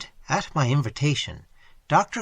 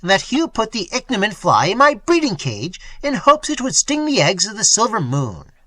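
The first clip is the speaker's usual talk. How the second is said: loud, higher accusing; shouting accusation; 7 semitones higher, 6 dB louder than usual. A narrator's reading. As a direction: hurl the charge at him. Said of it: "shouted Quint".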